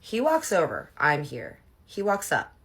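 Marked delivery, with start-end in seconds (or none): none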